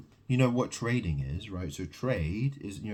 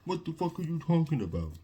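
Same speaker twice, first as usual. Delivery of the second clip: higher, mock-incredulous voice; mocking imitation; 6 semitones higher than usual. Imagining viewers dismissing him, mock incredulous. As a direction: play the outraged skeptic, lightly. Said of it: "you're gonna say".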